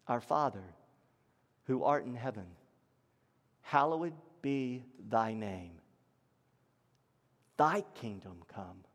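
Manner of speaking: low, hushed recital with long pauses; reverent recitation. Acted. Preacher leads church in the Lord's Prayer, reverent and hushed.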